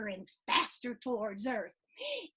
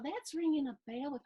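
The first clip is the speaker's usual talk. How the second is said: usual speaking voice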